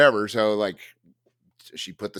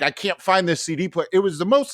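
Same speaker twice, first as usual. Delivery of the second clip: ordinary talk, nothing marked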